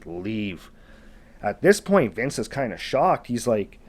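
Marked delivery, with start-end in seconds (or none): 0.1-0.7 soft, low creature rasp; imitated menacing low voice; low, deep, raspy voice. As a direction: drop deep and quiet, with rasp. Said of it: "he hears this low, deep, raspy voice just say".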